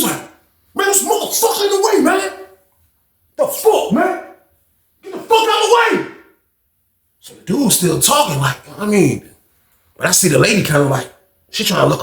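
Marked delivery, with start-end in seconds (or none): 0.7-6.1 high-pitched mock angry outburst; snapped. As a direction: act out a man losing it, voice way up high.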